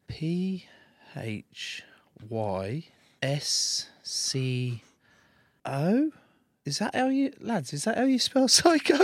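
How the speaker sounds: slow spelled letters, some whispered, rising in disbelief; disbelieving slow spelling, voice climbing; spelling it out incredulous. Retold or imitated. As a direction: sound each letter out, baffled, then laugh. Spelling out misspelt shirt.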